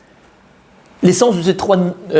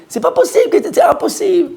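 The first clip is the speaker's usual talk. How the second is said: incredulous disbelief; 13 semitones higher than usual. Acted. Brothers doubting Yosef stayed righteous.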